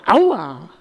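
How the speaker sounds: loud yelp after a kick; pained yelp. A narrator's reading. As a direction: cry out in sudden pain. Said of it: "Aber dann schrie er".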